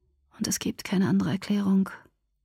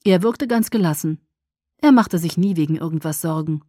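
plain reading voice

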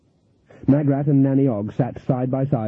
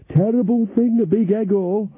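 high, slow feeble voice; Nanny Ogg weakly admits Granny's pride; weak old Nanny; 9 semitones higher than usual. Acted.

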